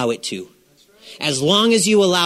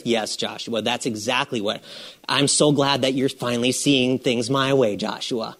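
sarcastic God; 6 semitones lower, 4 dB quieter than usual. Retold or imitated. Sarcastic God answering Joshua.

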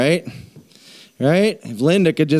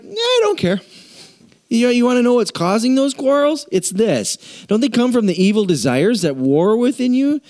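higher, brisk no-nonsense apostle; blunt James; 9 semitones higher than usual. Acted. James cutting off the whining congregant bluntly. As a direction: brush the excuse aside. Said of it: "James is like".

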